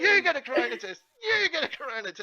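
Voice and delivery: high, excited giveaway chant; Oprah impression. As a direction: announce each prize to the cheering crowd. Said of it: "be like Oprah".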